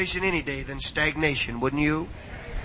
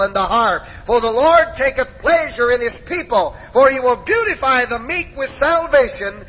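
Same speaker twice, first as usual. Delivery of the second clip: high, forceful scripture reading rolling on verse after verse; exuberant preaching; 6 semitones higher, 5 dB louder than usual. Acted. Preacher reading of Israel rejoicing to the congregation, exuberant preaching. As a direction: drive the psalm home to the crowd.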